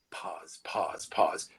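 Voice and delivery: Pause, pause, pause whispered low and slow; whispered reminder from the boss; whispered coaching. Shown as a demonstration. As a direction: hush each pause like a coach at your ear. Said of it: "the most important thing is pause".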